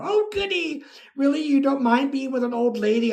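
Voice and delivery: high, quick mock-delighted old lady; retold girlish delight. Old lady thrilled he will visit, high imitated delight. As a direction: imitate her squeal of glee, then quick chatter.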